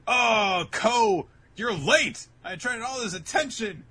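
high, loud, exasperated shout; Kageura, mock angry complaint. Kageura berating Ko for being late, acted angry shout. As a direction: shout an irritated scolding at a latecomer.

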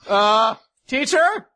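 high, questioning call, rising at the end; worried calling out. Acted. Student calling to teacher after a crash, worried.

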